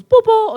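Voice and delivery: high, loud honking noise; imitated car hoot. Guy hooting at her on the road, loud imitated hoot. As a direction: toot it out like a car horn. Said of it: "some guy hooted at me".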